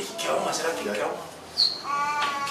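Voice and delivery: hushed, bewildered aside; stunned murmur. Acted. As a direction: ask under your breath, at a loss. Stunned disciples murmur to each other.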